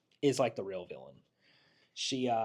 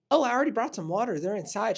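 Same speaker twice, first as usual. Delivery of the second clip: eager assistant; 10 semitones higher, 7 dB louder, faster than usual. Retold or imitated. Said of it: "And he goes".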